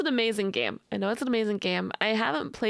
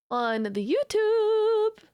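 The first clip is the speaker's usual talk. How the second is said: playful exclaim; 10 semitones higher, slower than usual. Shown as a demonstration. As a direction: proclaim "On the YouTube!" with glee. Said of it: "and I'll be putting it on the YouTube".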